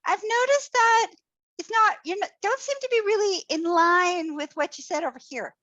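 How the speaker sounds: mock gentle diplomat easing into criticism; hedging, sugary tact toward an acquaintance; overly careful polite voice. Gentle call-out for less close people.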